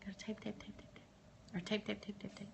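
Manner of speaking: soft whispered chant; whispering. Acted. Waiting for chat comments, teasing.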